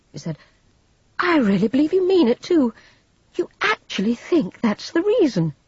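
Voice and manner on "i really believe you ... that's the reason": high, loud disbelief; agitated, high-pitched incredulity; incredulous. A narrator's reading. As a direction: throw it out in scornful amazement. Vivian incredulous in tense quarrel.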